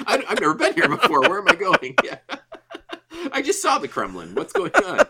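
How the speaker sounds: giggling through a lost Spider-Man's panic; laughing mock tourist. Cohost riffing as Spider-Man lost in Moscow, laughing.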